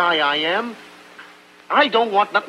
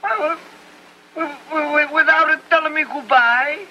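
put-on higher, stammering Italian-accented disbelief; dismayed stammer; 7 semitones higher than usual. Tony hears Buck left without goodbye, stammering Italian accent. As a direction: stumble over the words in shock, Italian accent.

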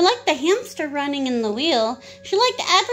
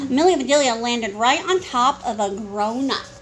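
ordinary delivery, nothing marked

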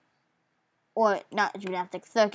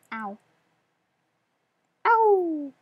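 ordinary delivery

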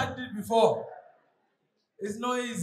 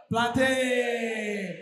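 swaggy hawker call. Retold or imitated. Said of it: "Then they add the swag".

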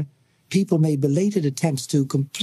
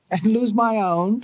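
a light smiling question, higher; with a smile; 6 semitones higher than usual. Acted. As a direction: tease him with a smile. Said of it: "said Tommy with a smile".

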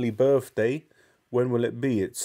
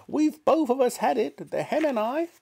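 put-on shouting old grandma. Grandma shouting from the rooftop, high and crowing. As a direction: crow the words out proudly like an old witch. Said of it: "Grandma shouted".

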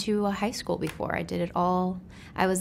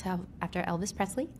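ordinary delivery

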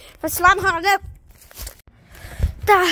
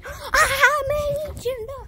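mock teasing; 7 semitones higher, much slower than usual. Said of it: "by going".

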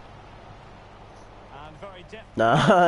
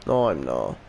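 much lower, flat denial; low mutter; 13 semitones lower than usual. Real, not acted. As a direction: drop the voice right down.

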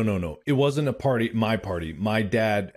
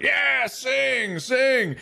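high mock cheer from dad; urging dad; 13 semitones higher, much slower than usual. Dad pushing his son to sing at the party, high mock urging. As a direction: prod the kid on, high-pitched. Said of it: "my dad's like".

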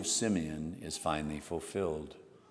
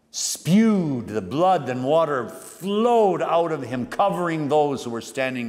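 louder, higher, slow preaching surge; fervent outburst; 7 semitones higher, 6 dB louder than usual. Real, not acted. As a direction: burst out as the blood pours. Preacher erupts describing the wound.

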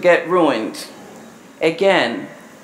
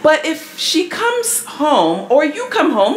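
ordinary delivery

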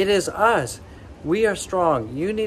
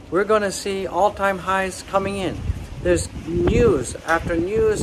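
usual speaking voice

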